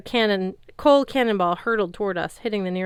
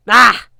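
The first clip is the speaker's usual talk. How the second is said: startled cry; 4 semitones higher, 16 dB louder than usual. A narrator's reading.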